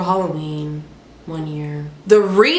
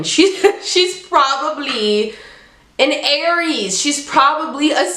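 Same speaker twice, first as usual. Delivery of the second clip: laughing; 6 semitones higher than usual. Real, not acted. Joking about the car's star sign.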